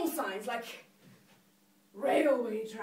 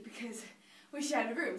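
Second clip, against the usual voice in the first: ordinary talk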